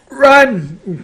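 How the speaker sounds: high, loud panicked bleat; loud goat-like cry. A narrator's reading. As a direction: bleat it out in terror. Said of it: "Grover bleated".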